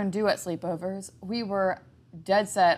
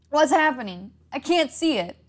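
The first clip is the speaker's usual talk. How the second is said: panicked; 8 semitones higher, 7 dB louder than usual. A narrator's reading. Jack panicking, being strangled.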